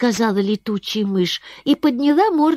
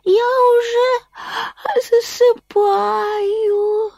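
slow, drowsy, drawn-out; sleepy voice; much slower than usual. Acted. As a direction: drift off as you speak. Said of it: "сонным голосом".

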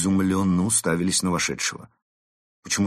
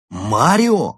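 higher, louder astonished cry; stunned exclaim; 7 semitones higher, 8 dB louder than usual. Acted. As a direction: cry out the name in shock. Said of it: "хором воскликнули ошеломлённые".

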